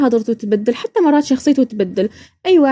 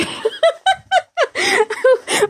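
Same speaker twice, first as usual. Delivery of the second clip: laughing. Real, not acted. Laughing about learning teasing.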